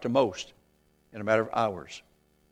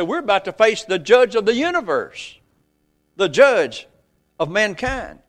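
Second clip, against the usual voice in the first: preaching; 3 semitones higher, 5 dB louder than usual. Acted. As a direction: press the thief's confession home, then settle on "innocent". Preaching the thief's words.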